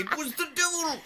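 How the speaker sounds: high, laughing mock villager; hammed-up devil line, laughing; spooky witness. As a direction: squeal it through a laugh.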